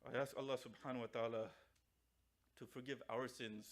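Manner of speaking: low, subdued supplication; closing prayer. Real, not acted. Preacher asks forgiveness for the departed, closing prayer.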